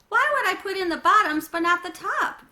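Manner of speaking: loud, high, exasperated demand; indignant question. Acted. Janie arguing with Mom over the laundry, loud and exasperated. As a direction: throw the question back, fed up.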